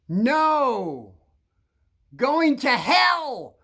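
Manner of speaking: furious nun screaming, slow and loud. Retold or imitated. Nun screaming at a student in class, loud and slow. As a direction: yell it at the boy, dragging each word. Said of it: "'cause she screamed".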